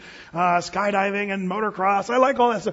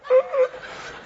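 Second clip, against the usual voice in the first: high strained squeal; acted stuck straining. Pastor stuck in the hole, acted panicked straining. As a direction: squeak and struggle as if wedged tight. Acting stuck, unable to move.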